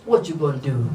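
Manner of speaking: comically low imitation American accent; attempted American accent. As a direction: put on a deep, casual American voice. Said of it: "Learning the American Accent".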